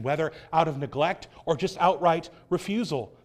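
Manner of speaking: normal talking voice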